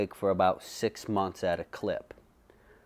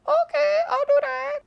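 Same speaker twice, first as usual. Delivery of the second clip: very high and louder mock voice of the barber; corrected barber impression; 4 dB louder than usual. Correcting his impression of the barber agreeing, very high and louder. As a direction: redo the barber, squeakier and louder. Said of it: "That's not really her voice".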